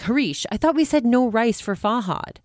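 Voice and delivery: mock rebuke. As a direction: put the chef in his place. Wife rebukes the chef.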